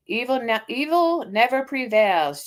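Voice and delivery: ordinary delivery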